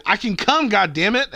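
high, loud indignant shout; mock outraged yell. Retelling his text to a buddy, mock outraged yell. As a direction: yell it like you've been insulted. Retold angry text reply.

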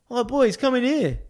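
high-pitched eager invitation; excited beckoning. Retold or imitated. Photographer waves his mates in at the side door, excited call. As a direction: imitate a keen friend calling them in. Photographer calls them inside.

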